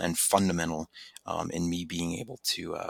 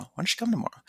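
nagging; 12 semitones higher, much faster than usual. Retold or imitated. Friend nagging, retold.

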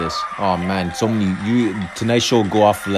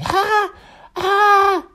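high yelping falsetto; mock falsetto wail. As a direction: squeal it like a parody. Mocking Fallon's singing again.

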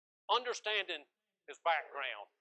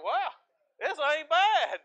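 pleased mountain man; 9 semitones higher, 6 dB louder, much slower than usual. Retold or imitated. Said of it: "Elijah's like".

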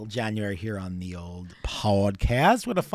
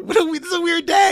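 silly high voice; 8 dB louder than usual. Acted. Topsy turvy day voices, high far.

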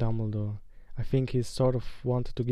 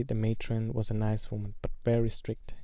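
ordinary delivery, nothing marked

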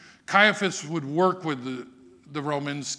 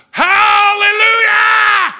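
a long, very high, loud sustained shout; rocks crying hallelujah; 16 dB louder, much slower than usual. Acted. The rocks praising their creator, long ringing shout. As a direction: let the whole creation roar it out. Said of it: "to hear the rocks go".

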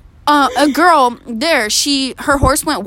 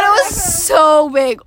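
SO stretched long and high; insist, dragging out "so"; emphatic insistence; 3 semitones higher, slower than usual. Real, not acted. Insists after joke about size.

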